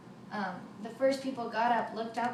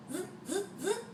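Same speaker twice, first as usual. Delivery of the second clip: saw noise; 8 semitones higher, 4 dB quieter, faster than usual. A narrator's reading. Saw sound imitated.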